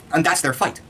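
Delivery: ordinary delivery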